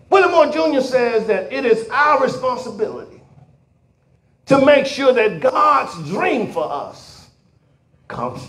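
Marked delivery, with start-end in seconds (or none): none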